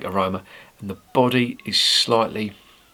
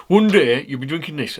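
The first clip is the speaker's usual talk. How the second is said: put-on botched Geordie accent, higher pitched; attempted Geordie accent; 6 semitones higher, 4 dB louder than usual. Quoting the big Geordie workmate's prediction, failed Geordie accent. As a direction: badly imitate a Geordie bloke, pitched up. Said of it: "that's not a Geordie accent".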